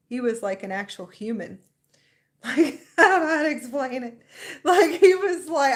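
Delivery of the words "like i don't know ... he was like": higher and louder, laughing through the words; laughing in disbelief. Real, not acted. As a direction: giggle it out, almost tearful. Laughing while describing Ra.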